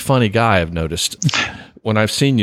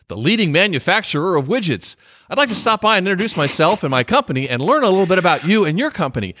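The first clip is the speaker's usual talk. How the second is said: higher-pitched, hammed-up announcer read of a cold-call pitch; 7 semitones higher than usual. Host reading a bad sales pitch aloud, hammy announcer voice. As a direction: sell it like a cheesy radio announcer. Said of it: "in that announcer voice that you just used so brilliantly".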